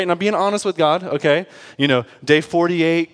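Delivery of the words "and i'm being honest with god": usual speaking voice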